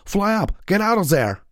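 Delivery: high, loud urgent yell; urgent shout. A narrator's reading. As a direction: scream the warning. Warning friend of danger.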